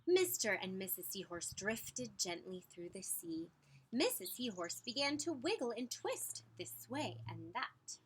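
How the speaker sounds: ordinary delivery, nothing marked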